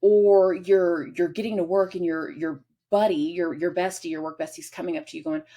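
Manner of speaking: normal talking voice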